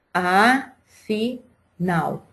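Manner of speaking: afinal very slowly as a... fi... nal; syllable by syllable. Shown as a demonstration. Teacher counting syllables for pupils, syllable by syllable. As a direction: separate afinal into three syllables with clear pauses. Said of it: "Quantas sílabas tem a palavra afinal".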